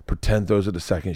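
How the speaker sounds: ordinary talk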